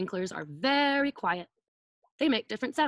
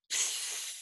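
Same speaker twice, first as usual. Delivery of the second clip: whispered "Psst" hiss; bus brakes hiss; 7 dB quieter than usual. Shown as a demonstration. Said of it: "Can you go".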